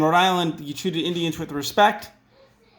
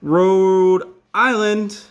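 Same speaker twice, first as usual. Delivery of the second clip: slow and drawn out; 5 dB louder, much slower than usual. Shown as a demonstration. Writing the name on map.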